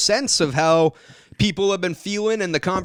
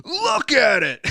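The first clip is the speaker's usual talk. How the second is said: high, stretched mock shout; as if grabbing the viewer by the face; imitated urgent insistence; 12 semitones higher, slower than usual. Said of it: "like you're grabbing the viewer's face".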